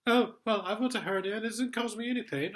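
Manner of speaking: high, loud sneering parody of the sergeant; mocking the sergeant. Quoting the sergeant who laughed at him, high mocking parody. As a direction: ape him in a silly high voice.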